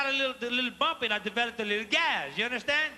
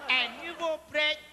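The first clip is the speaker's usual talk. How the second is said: put-on old woman's voice; 3 semitones higher, slower than usual. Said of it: "Elle dit".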